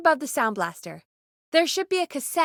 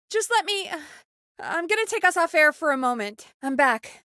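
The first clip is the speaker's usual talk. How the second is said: normal talking voice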